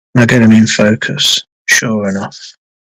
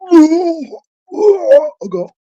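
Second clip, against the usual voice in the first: mock panicked cry. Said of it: "I'm going".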